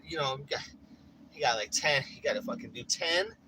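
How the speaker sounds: ordinary talk, nothing marked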